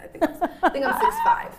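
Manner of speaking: high laughing squeal. Real, not acted. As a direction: laugh through the joke. Joking she is a giant.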